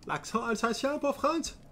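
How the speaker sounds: high, slow mocking repeat. Streamer mocks a viewer's request in a high silly voice. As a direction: squeak the question back, teasing.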